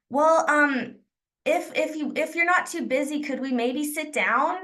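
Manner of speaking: high, halting, tentative request; hesitant asking. Shown as a demonstration. Trainer showing the weak way to ask to sit down, hesitant. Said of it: "there's a big difference in saying".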